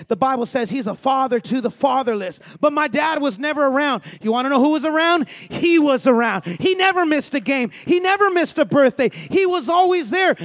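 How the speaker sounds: loud, high, driving sermon voice; full-force preaching. Real, not acted. Pastor rousing the congregation about God, loud full-force preaching. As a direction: preach it hard and loud to the room.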